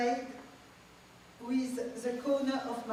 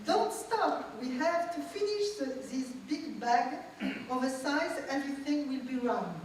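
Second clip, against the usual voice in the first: put-on higher, bright old grandmother urging, with a laugh; grandmother's voice; 4 semitones higher than usual. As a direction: play the insistent grandmother coaching her grandchild. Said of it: "She says to me".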